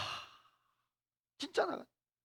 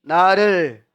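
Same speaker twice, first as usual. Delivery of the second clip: slow, lower call for the congregation to echo; slow call to repeat; 4 semitones lower, 5 dB louder, much slower than usual. Shown as a demonstration. Preacher leading the congregation, slow repeat-after-me call. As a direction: stretch it out to lead them. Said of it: "따라서 합시다".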